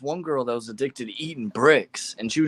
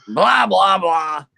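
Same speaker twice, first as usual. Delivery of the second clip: higher, louder theatrical vampire-style blah blah; Crow impression; 7 semitones higher, 6 dB louder, much slower than usual. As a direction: throw out a hammed-up spooky blah, blah, blah. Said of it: "We'll do Crow".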